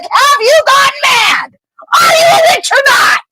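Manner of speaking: very high, shouted outburst; exasperated shout. Acted. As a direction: yell in disbelief at her. Ron loses it.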